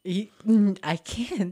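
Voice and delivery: high, loud laughing refusal. Real, not acted. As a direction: laugh it off, can't do it. Refusing farm life, laughing.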